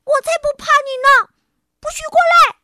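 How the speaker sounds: loud, high, fast frightened yell; scared shouting. Acted. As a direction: yell bravely through the fear. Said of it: "我冲他叫起来". (害怕地尖声大喊)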